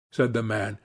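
usual speaking voice

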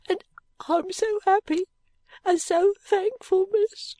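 put-on high child's voice climbing with emotion; little girl, heaving heart. A narrator's reading. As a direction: build to overflowing gratitude as a small maid. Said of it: "cried Charley with a heaving heart".

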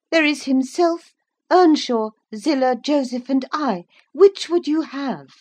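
high, loud, crisp reply; haughty young woman. Acted. Listing the household to a stranded visitor, haughty young woman. Voices a woman character's answer.

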